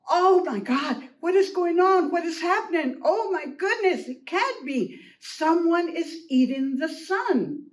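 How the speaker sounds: alarmed hen, high and emphatic; alarmado. Acted. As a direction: cry out in storybook alarm.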